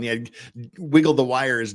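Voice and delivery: usual speaking voice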